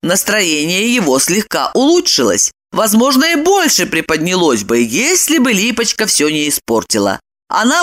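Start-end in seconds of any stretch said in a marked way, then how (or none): none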